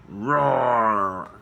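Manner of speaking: loud pretend lion roar, falling away. Acting out anger as a lion for the kids, playful loud roar. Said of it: "And roaring like a lion".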